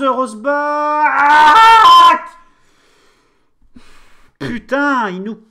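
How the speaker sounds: loud, high furious shout at the keeper; angry yelling. Real, not acted. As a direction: yell curses at the blunder. Keeper's error, cursing outburst. (cri de colère)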